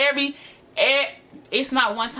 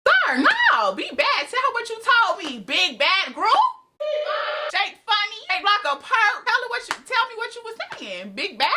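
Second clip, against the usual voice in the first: squeaky-high mocking taunt; put-on high mocking voice; high-pitched taunting; 11 semitones higher than usual. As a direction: taunt them way up in your high register.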